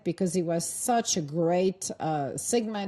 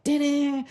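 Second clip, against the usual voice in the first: surprise fanfare; 7 semitones higher than usual. Retold or imitated. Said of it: "it's like".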